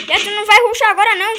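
fast, high, loud command; urgent warning. Real, not acted. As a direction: bark a quick warning. Warning teammate not to rush.

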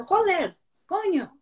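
high mock-angry cursing of a grandpa. Grandpa cursing while angry, a mock cranky outburst. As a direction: swear like a cranky grandpa, playfully. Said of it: "el abuelo se enfada y dice".

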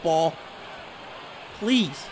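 usual speaking voice

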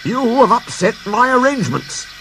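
high-pitched, huffy official complaint; indignant. A narrator's reading. Controller scolding over his upset arrangements, indignantly. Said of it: "went on the fat controller indignantly".

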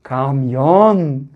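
"Kamyon" low, in two held syllables, "kam" then "yon"; blending syllables. Shown as a demonstration. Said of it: "Birleştiriyoruz". ("Kamyon" sözcüğünü heceleyerek okuma)

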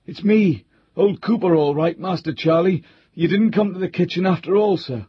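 put-on old butler Cooper. A narrator's reading. Old butler answering the frightened squire through the door. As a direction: call through to reassure his master.

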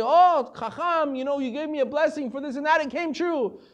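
lightly voiced thrilled follower; grateful excitement. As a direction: gush thanks like the blessing just came true. Grateful person thanking the sage.

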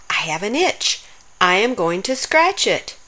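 plain reading voice

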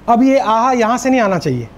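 ordinary delivery

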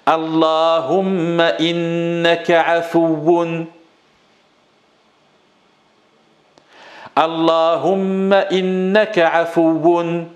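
teacher reciting the invocation for echo; call and repeat drill. Shown as a demonstration. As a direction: lead the whole phrase for the class. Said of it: "Allez avec moi".

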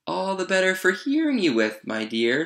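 high, swooping fake granny; wolf as grandma. A narrator's reading. Wolf posing as grandma replies.

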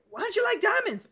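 high-pitched imitation of a baffled friend; mock a puzzled friend, pitch jumping up; weirded-out question. Friend questioning the speaker's taste in gems, weirded out. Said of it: "He looks at me all weird, like".

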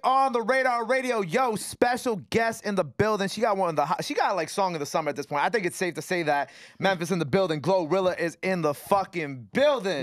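loud, high-energy guest announcement; big radio show opener, words stretched for hype; hyped radio host intro. Real, not acted. Host introducing special guest to listeners, hyped announcing.

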